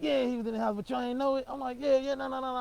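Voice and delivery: high, loud heated back-and-forth; heated retort. Retold or imitated. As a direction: argue it out loud. Uncle's and own heated exchange.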